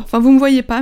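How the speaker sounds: normal talking voice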